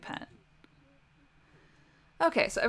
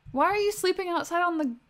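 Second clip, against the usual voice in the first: surprised outcry; 6 semitones higher, 4 dB louder than usual. Real, not acted. Surprised at sim outside.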